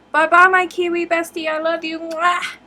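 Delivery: high, loud, gushing farewell with a kiss; shower your bestie with love; gushing goodbye. Real, not acted. Farewell to friend on live.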